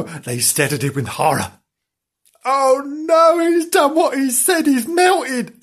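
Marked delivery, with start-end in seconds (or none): 2.4-5.6 horrified. Acted. Friend has melted.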